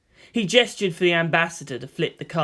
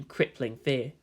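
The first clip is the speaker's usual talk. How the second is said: ordinary delivery, nothing marked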